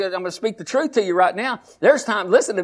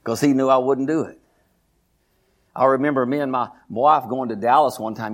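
usual speaking voice

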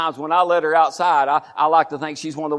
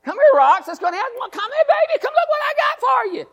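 high cooing voice coaxing a dog; pet-talk baby voice calling the dog; sweet talk to a dog. Retold or imitated. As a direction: coo at your little dog, way up high. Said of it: "I'm talking to her".

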